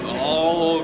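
usual speaking voice

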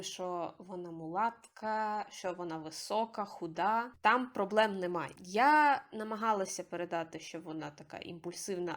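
ordinary delivery

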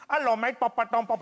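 high, hammed-up bad Indian accent. Stranger coming up to comedian doing a bad Indian accent. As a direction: badly mimic an Indian accent, pitched high. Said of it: "does a really bad Indian".

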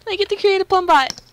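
high, loud squeal of excitement; so excited. Real, not acted. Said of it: "I'm so excited".

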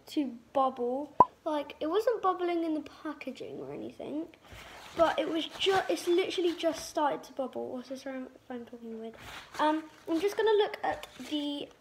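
sheet mask covering mouth; speaking with wet mask on face; through a face mask. Real, not acted. Seen 2: Sheet mask covers face including around mouth throughout labelled words.

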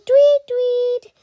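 very high, loud chirpy tweets; bird tweet. Acted. Voicing the bird.

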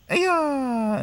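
battle cry. Acted.

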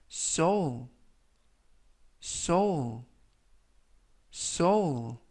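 soul said slowly and clearly three times, for learners to repeat; careful pronunciation demo. Shown as a demonstration. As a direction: model soul carefully, with a pause between each take. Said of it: "이라고 발음하시면 되겠습니다". (soul을 또박또박 세 번 발음 시범)

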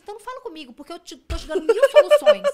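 high-pitched, exasperated argument; exasperated retort. Retold or imitated. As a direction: argue back at someone who rejects every solution. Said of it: "Eu falei assim". (desabafo exasperado, em voz aguda)

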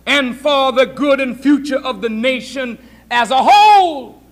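ordinary delivery

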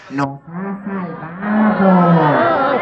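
very slow nasal alien. Shown as a demonstration. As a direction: push it into the nose, drawn out. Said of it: "echen la voz a la nariz y digan".

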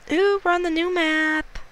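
delighted squeal. Real, not acted. Gamer excited to see the new map, delighted squeal. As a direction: gush over the new map.

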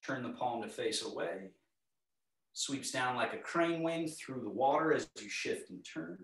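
calm, unhurried movement cues; serene tai chi cues. Acted. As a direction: guide the class gently through each move.

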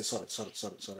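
very soft, low mumbled apologies; meek repeated apology. Retold or imitated. Worker with a Nigerian mentality answering her boss, meek and hushed. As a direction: imitate someone apologising submissively under their breath.

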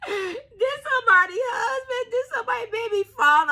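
high, loud laughing squeals; can barely speak for laughing. Real, not acted. Cracking up over the cards.

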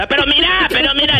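high-pitched insistent warning; retold urging. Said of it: "le decía yo". (advertencia insistente en tono agudo)

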